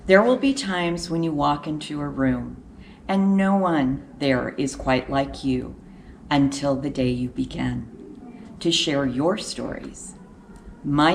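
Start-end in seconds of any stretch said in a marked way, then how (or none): none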